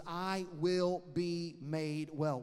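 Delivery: very slow, "will" hit hard and sure; stress on will. Shown as a demonstration. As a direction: drag it out, punch "will" with faith. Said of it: "She goes".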